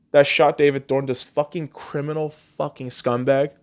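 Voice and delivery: ordinary delivery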